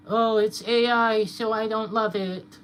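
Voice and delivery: high, slow sneering whine; mock whiny AI hater. Streamer mocking people who reject AI, high slow whine. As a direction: drag it out like a snob. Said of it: "if I'm gonna say".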